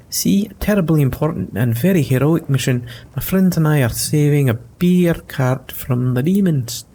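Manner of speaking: a startled small fellow relieved it's not demons; squeaky-high halfling, flustered and polite; nervous halfling voice. Acted. Halfling boasts.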